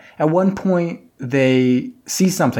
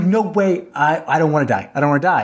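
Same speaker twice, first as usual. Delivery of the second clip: mock panicked protest, pitched up; acting out a frightened refusal; freaking out; 5 semitones higher than usual. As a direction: plead in a higher, retold panic. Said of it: "he's, like, freaking out".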